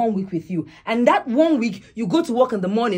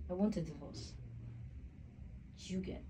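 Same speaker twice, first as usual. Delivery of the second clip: quiet resolve; 4 semitones lower, 20 dB quieter than usual. Acted. Wife quietly announcing divorce.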